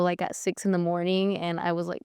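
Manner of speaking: ordinary talk, nothing marked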